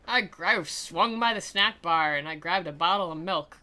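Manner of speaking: usual speaking voice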